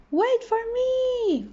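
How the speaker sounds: high, slow, stretched-out call; a small fish yelling after friends; shouting. A narrator's reading. Little fish shouts after friends swimming off, high and drawn out. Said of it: "shouted little fish".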